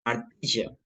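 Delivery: "martillo" with a "sh" sound for the "ll"; regional pronunciation. Shown as a demonstration. Showing how some people talk, "martillo" said with a sh sound. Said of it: "como hablan algunas personas".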